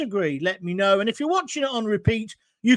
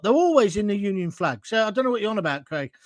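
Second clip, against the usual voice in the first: normal talking voice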